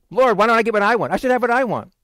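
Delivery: high, quick, demanding protest; selfish complaint. Acted. Selfish believer demanding things from the Lord, complaining. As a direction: push it up high like an entitled complaint.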